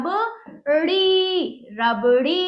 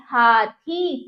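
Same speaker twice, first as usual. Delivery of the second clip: हाथी slow and lower, each syllable held; drawn-out vowels; 4 semitones lower, 3 dB louder, much slower than usual. Shown as a demonstration. Teacher reads the word to children, drawn out. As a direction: stretch हा and थी in हाथी. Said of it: "हम इसको खींचेंगे".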